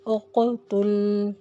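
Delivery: उक़तुल with a clear echoing bounce on क़, slow; qalqala on qaf. Shown as a demonstration. Tajweed teacher showing the bounce to students. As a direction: shake the क़ in उक़तुल. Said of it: "हिलाकर पढ़ा".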